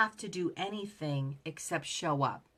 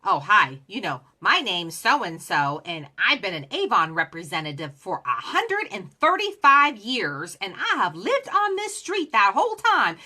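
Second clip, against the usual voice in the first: imitating an irate rep, much louder; territorial rant; 7 dB louder than usual. Rival Avon rep phones to complain about her turf, loud mock anger.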